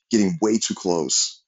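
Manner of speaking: ordinary delivery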